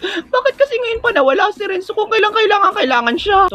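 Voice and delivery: put-on high, jittery girl voice; nervous girl pitched high before the quiz; nervous schoolgirl. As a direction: squeak it out, scared of the contest.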